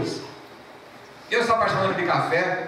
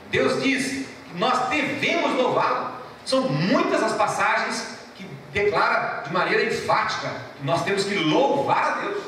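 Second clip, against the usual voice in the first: ordinary talk, nothing marked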